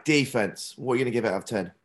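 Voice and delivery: ordinary delivery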